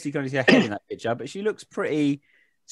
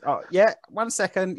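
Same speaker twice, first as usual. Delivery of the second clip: put-on old lady voice; 8 semitones higher than usual. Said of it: "she goes".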